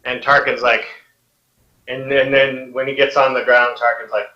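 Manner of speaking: ordinary delivery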